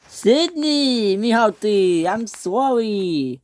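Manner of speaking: high, drawn-out cry of apology; apologetic calling. Acted. Salty to Sydney after hitting him, apologetic cry. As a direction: call out high, stretching the words.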